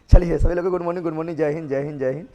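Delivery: loud, fast, laughing hello to the class; laughing greeting. Real, not acted. Teacher greeting the online class, laughing and loud. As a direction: burst out laughing into a cheery good morning.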